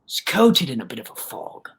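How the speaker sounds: usual speaking voice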